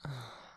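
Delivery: soft, low sigh; short, falling sigh. Acted. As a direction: let out a quiet sigh. Patient lies hurting, partner reacts.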